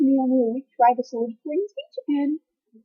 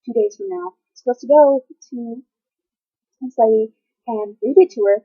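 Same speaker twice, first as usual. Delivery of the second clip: usual speaking voice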